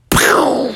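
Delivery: high sharp pow; pop sound. Acted. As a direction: make the ears burst. Ears pop.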